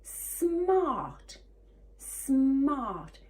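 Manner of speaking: SMART with stretched S and M, high then falling; slow, high, falling "smart"; careful word demo. Shown as a demonstration. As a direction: draw out the start of SMART. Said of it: "word we're going to do".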